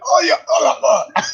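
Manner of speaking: high mock gasps after a burning breath; exaggerated high, gasping yelps; imitated gasping reaction. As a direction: act out breathing in pure chilli. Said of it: "as soon as you breathe in".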